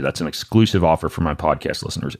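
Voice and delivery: ordinary talk, nothing marked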